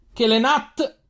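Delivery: sudden high yell of warning; frantic warning shout. A narrator's reading. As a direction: cry out the name in alarm. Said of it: "urlò Firan".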